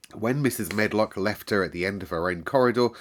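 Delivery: ordinary delivery, nothing marked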